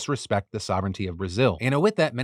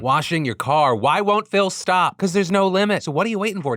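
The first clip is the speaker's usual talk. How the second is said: louder and higher, whipping the ad list into a frenzy; escalating mock rant; 7 semitones higher, 7 dB louder than usual. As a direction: pile it on until you're nearly yelling, a hammed-up sales frenzy.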